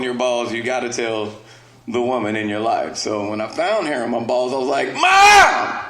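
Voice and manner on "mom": put-on a kid's loud, high cry of discovery; excited kid yelling. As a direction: shout it high and loud like a boy. Kid calling his mom.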